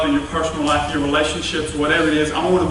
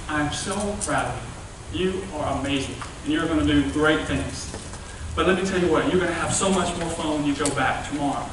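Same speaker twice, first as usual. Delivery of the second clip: softer, lower, gentle mother's voice; tender mom; 3 semitones lower, 8 dB quieter than usual. Acted. Mama gently praising Cindy after kindergarten, soft and tender. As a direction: soothe her like a proud mom.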